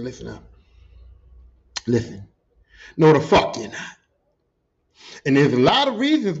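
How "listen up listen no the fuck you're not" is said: low, serious warning, building to a loud refusal; stern warning. Retold or imitated. Retelling warning an angry coworker off a fight, low and stern. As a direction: drop the pitch and lay down the law.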